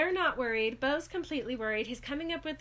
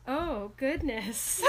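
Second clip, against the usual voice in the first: slow flustered oh goodness; much slower than usual. Retold or imitated. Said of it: "I just wrote".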